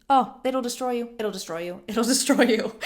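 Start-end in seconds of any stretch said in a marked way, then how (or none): 1.9-2.8 louder, laughing through the warning. Real, not acted. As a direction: laugh as you say it. Laugh while repeating warning.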